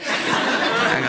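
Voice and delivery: normal talking voice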